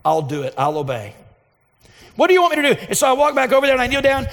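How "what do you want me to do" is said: higher, louder pleading question; an earnest cry for direction; loud plea. Retold or imitated. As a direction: call out to God, urgent.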